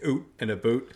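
comic Canadian vowels on "oot", "a boot" and "bean"; Canadian accent. Shown as a demonstration. Showing co-hosts the cheat for a foreign accent, Canadian accent. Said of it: "I'd do a Canadian accent and just say".

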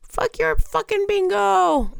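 high, drawn-out playful holler; demonstrated winner's yell. Shown as a demonstration. Host repeating the winner's cry for listeners, playful yell. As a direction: call it out long and high, as a mock victory cry. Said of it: "and you yell".

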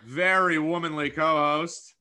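high, slow, hammed-up showman intro; grand announcer voice. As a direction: oversell the co-host like a game show host. Hammed-up podcast intro of co-host.

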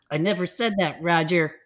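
ordinary delivery, nothing marked

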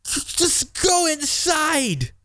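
a dad at the end of his rope sending his son away; retold frustrated outburst. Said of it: "And I said to him".

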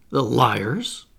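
indignant outburst; with feeling. Acted. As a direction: curse the lying authors. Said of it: "With feeling".

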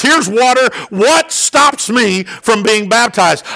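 normal talking voice

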